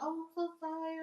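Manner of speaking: over-the-top apologetic stranger; embarrassed caller apologizing. Retold or imitated. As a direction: gush a mortified sorry.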